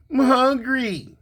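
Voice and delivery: put-on high, slow kid's whine; whiny child. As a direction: stretch it out like a pouting boy. Grandson voiced on the phone.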